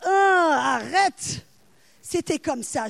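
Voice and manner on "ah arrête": loud drawn-out warning; mock alarm cry. Preacher voices the inner warning to stop, loud alarm cry. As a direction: sound the alarm, stretching the first cry.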